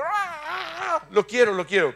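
mock-eager cry of a crowd grabbing a product. Preacher mimics the eager buyers, a gleeful grab. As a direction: squeal it like someone who just has to have it.

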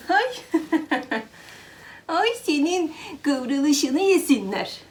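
slow, high cooing over the cat; doting coo. Real, not acted. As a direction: melt over the cat curling up. (kedinin kıvrılmasına yavaş, tiz bir hayranlıkla)

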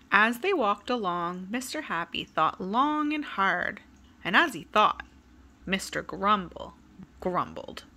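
put-on lively, sing-song read-aloud for kids; storybook reading. A narrator's reading. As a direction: tell it like bedtime story time. Reading a picture book to children.

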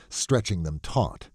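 ordinary delivery, nothing marked

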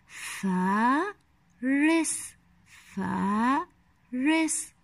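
فارس slowly in two parts, long فا, pause, then رس; very slow فارس, each syllable separate; split into syllables. Shown as a demonstration. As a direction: sound out فارس with a gap between syllables. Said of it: "لدينا مقطع صوتي طويل".

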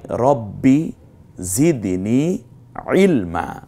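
slow recited রব্বি জিদনি ইলমা with the final a drawn out; measured recitation of রব্বি জিদনি ইলমা; recited verse. Shown as a demonstration. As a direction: recite it, stretching ইলমা's last vowel. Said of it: "হবে ইলমা".

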